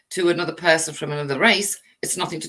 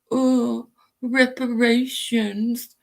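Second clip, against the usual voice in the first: mocking drawl; 5 semitones higher, much slower than usual. Mocking the word reparations.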